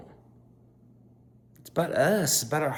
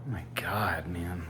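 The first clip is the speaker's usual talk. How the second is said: soft, low, spent whisper; drained whisper; 7 semitones lower, 12 dB quieter than usual. Real, not acted. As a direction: let the air out after the rant. Deflating after the rant.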